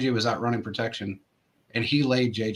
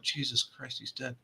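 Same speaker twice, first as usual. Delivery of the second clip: much softer, an inward thought; hushed dread; 11 dB quieter than usual. Retold or imitated. Recalling his shock at seeing the teammate down, hushed. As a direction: drop to a hushed, stunned thought.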